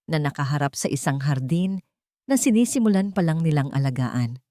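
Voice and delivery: usual speaking voice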